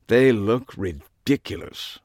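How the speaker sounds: slow British disdain; affected British, slow and scornful; affected British accent. A narrator's reading. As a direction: pass judgement in drawn-out affected British. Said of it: "either a British accent or a deep-seated affectation".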